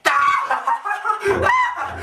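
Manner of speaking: high, wild cackle; manic laugh. Acted. Madman laughing at his own punchline, manic cackle. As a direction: burst out cackling at your own joke.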